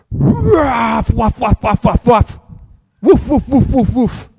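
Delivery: high loud yapping woofs; loud, high dog barks, one after another; barking dog. Acted. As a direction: bark like an angry little dog. Story dog barks at postman.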